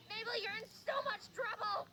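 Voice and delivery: high-pitched scolding from far away; mock yell of a cross woman; lady yelling. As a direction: holler high across a lake. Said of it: "some lady was yelling".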